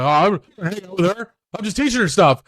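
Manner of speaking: high, loud mock protest; defensive self-reassurance. Man defending his time with a girl, defensively self-reassuring. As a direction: insist on it to convince yourself. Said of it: "reassure himself that he's doing the right thing".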